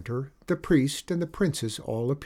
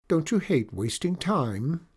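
cartoon princess; 6 semitones higher than usual. Princess voiced in story.

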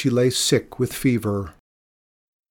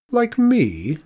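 indignant; 12 semitones higher, 4 dB louder than usual. Acted. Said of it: "Mikola tossed her head".

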